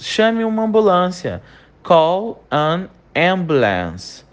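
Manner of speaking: ordinary delivery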